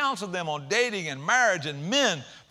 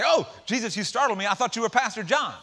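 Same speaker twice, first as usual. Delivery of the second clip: higher, louder mock surprise; mock startled greeting; 5 semitones higher, 4 dB louder than usual. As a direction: play it as a jokey startled gasp. Pastor jokes what she should say.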